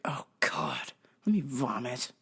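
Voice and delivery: whispered "let me vomit" in disgust; a hushed retold gag. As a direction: breathe it out, sickened. Disgusted whispered aside to herself.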